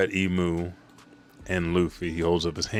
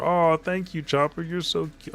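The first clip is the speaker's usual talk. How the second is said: cutesy thanks; 10 semitones higher than usual. Acted. Nami hugs Chopper.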